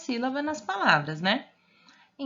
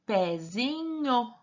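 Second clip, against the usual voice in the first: Pezinho stretched slowly, every syllable held; drawn-out trial reading; much slower than usual. Shown as a demonstration. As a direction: sound out Pezinho at a crawl, lingering on nho. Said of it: "Agora, vamos tentar com nho". (Pezinho bem arrastado, sílaba por sílaba)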